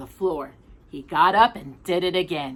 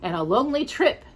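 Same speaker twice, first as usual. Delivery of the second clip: plain reading voice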